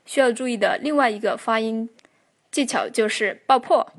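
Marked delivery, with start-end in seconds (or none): none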